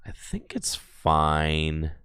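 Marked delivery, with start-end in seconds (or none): 0.1-0.7 under-breath whisper. Real, not acted. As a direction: whisper it to yourself. Muttering to himself mid-game.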